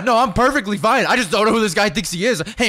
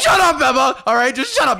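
heated, raised-voice retort; angry; 4 semitones higher than usual. Acted. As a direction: cut the argument off crossly. Cuts off argument angrily.

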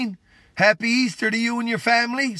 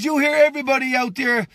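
usual speaking voice

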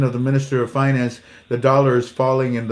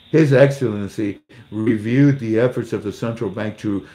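ordinary talk, nothing marked

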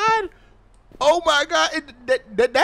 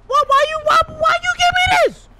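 high, loud bewildered question; startled by the gift; shocked outcry; 14 semitones higher, 5 dB louder than usual. Real, not acted. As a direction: demand why in alarm. Confused by gift.

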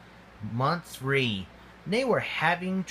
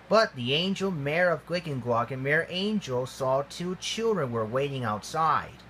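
ordinary delivery, nothing marked

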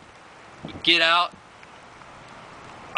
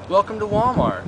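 high mock greeter voice; greeter's voice; 8 semitones higher, 3 dB louder than usual. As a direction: chirp it like a Walmart greeter. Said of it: "He's like".

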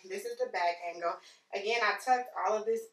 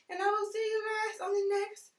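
high sing-song sign-off; sing-song outro; 13 semitones higher, 4 dB louder than usual. Real, not acted. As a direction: lift it up playfully. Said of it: "sing-song sign-off".

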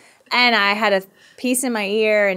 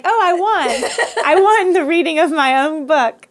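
high, drawn-out mock excitement, laughing; mock triumph; 7 semitones higher, 5 dB louder, slower than usual. Author mocking her win reading her own book, mock triumph. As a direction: crow over a ridiculous victory.

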